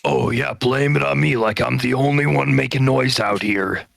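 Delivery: ordinary talk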